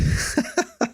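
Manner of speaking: short high laugh; quick amused laugh. Real, not acted. Laughs at own voice.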